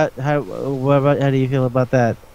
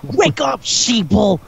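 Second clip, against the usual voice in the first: conspiracy theorist; 8 semitones higher, much slower than usual. Retold or imitated. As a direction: yell it like a street-corner crank. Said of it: "my favorite conspiracy crazy person thing".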